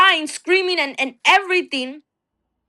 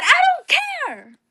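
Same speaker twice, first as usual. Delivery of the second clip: much higher, slow cry; retold scream; 12 semitones higher, slower than usual. Wife held back from the gate, much higher slow cry. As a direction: scream past the people holding you. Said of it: "I was screaming".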